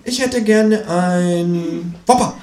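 high voice, very slow order; slow-motion high kangaroo voice; stubborn kangaroo. Acted.

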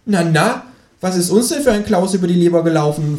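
high-pitched kangaroo voice; tut-tut high as the kangaroo; chiding kangaroo. Acted. Kangaroo chides the grumpy narrator, high creature voice. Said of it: "fragt das Känguru".

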